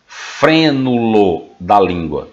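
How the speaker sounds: slow, every syllable spelled out; slow dictation. Shown as a demonstration. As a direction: dictate it slowly for note-taking. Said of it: "pra vocês copiarem".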